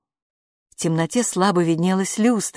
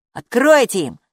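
raised, louder call; 6 semitones higher, 6 dB louder than usual. A narrator's reading. Kaapo calls from the children's room, raised shout. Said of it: "крикнул Каапу из детской".

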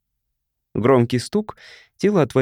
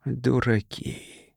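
very soft, slow whispered «Дураки» with falling end; 6 dB quieter, much slower than usual. A narrator's reading. Ethil in his cell watching rockets, whispering softly. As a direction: breathe the word out quietly. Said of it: "шептал Этил".

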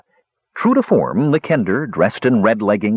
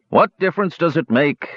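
dismissive stranger, pitched up; a touch of a gruff challenge; growled retort; 7 semitones higher than usual. A narrator's reading. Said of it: "growled the stranger".

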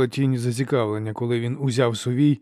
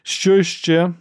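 higher, louder impatient demand; snorting impatience; 7 semitones higher, 5 dB louder than usual. A narrator's reading. Archlector snorts and presses the inquisitor for more news, impatient. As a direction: demand the next item, dismissing the last. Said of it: "Сульт пирхнув".

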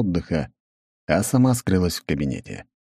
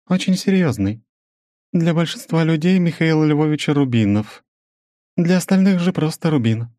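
measured, higher female voice; woman's voice; 10 semitones higher, 3 dB louder than usual. Acted. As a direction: narrator voicing a businesswoman, higher. Svetlana voiced by narrator.

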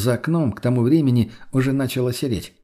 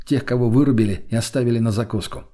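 usual speaking voice

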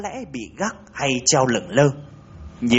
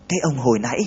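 usual speaking voice

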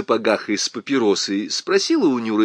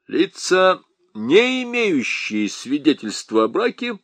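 high-pitched, recited bureaucratic drone; droning official reading; 6 semitones higher than usual. A narrator's reading. Lieutenant reels off a regulation to Nyura, officious droning. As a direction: recite it like a written decree. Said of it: "забубнил он".

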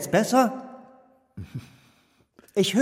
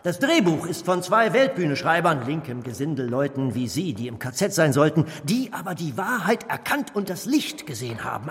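normal talking voice

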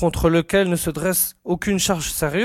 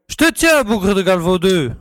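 triumphant shout; 3 semitones higher, 4 dB louder than usual. Acted. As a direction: pounce on him with a cry. Guard catches a prowler at night.

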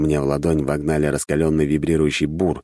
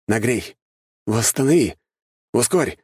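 higher-pitched, separate commands; commanding, raised pitch; barked-out orders; 6 semitones higher than usual. A narrator's reading. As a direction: issue each order as its own burst. Rapid-fire orders being issued.

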